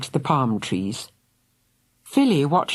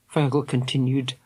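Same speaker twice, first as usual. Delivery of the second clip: ordinary delivery, nothing marked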